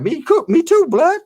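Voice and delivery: very high, mock-agreeing voice; mocking squeal.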